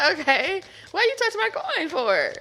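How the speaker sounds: squeaky, loud put-on whine; high indignant voice. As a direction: play the offended one, high and loud. Acted dialogue, second voice.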